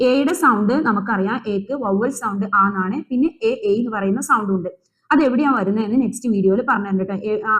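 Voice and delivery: normal talking voice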